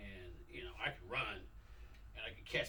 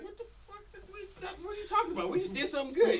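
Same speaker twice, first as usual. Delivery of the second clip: baffled protest; 5 dB louder, faster than usual. Retold or imitated. Players' baffled protest, acted.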